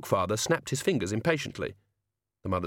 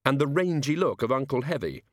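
ordinary delivery

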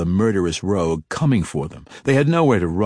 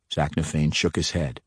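ordinary delivery, nothing marked

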